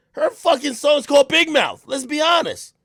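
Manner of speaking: loud, high-pitched shout; heated rant. Real, not acted. Arguing his point about her song, heated shouting. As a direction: shout it out in exasperated argument.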